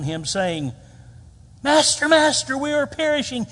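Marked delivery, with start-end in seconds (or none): 1.6-3.5 high, loud panicked cry; under stress. Acted. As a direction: cry out in alarm to wake the master. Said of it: "they're under, they're under stress".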